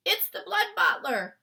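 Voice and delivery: loud, high frightened call; alarmed cry. Acted. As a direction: warn her the giant is coming. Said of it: "cried the B F G".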